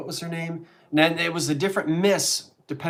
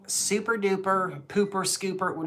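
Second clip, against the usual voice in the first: lightly imitated kids' show hostess, higher and slow; imitating TV hostess; 5 semitones higher than usual. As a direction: recite it slowly like a Baltimore TV lady. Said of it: "what did she say".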